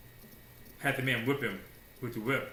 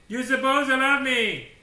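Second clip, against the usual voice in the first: needy demand; 11 semitones higher, 11 dB louder than usual. Acted. Voicing a needy person.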